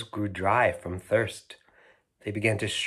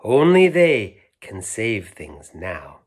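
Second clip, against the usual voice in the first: louder, slow solemn warning; mysterious toy; 9 dB louder, slower than usual. Acted. Mysterious toy warning the insects, slow and grave. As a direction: press it home gravely. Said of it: "said the mysterious long-lost toy".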